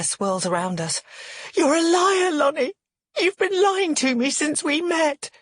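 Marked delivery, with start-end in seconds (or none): 1.5-5.3 high-pitched tearful accusation; furious sob. A narrator's reading. Woman calls the man a liar, furiously sobbing. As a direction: hurl it through furious tears. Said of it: "in a furious sob".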